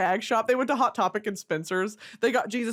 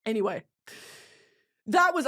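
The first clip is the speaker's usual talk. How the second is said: ordinary talk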